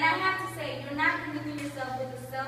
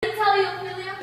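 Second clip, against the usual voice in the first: stern; 5 semitones higher, 8 dB louder than usual. Acted. Polonius scolding his daughter.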